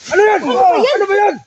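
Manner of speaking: high-pitched yells of protest; alarmed shouting. Real, not acted. Man objects as friends start fighting, alarmed shout. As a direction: cry out to stop a brawl.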